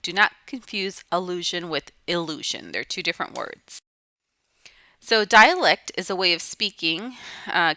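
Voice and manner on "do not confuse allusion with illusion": ordinary talk, nothing marked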